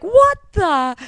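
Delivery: loud mock disbelief; shocked. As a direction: caught completely off guard. Family reacts shocked.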